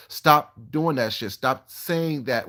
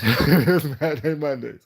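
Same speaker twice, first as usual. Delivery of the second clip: slow, laughing and louder; 4 dB louder, slower than usual. Real, not acted. Vlogger laughing at her own Mad Mondays catchphrase. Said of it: "Mad Mondays".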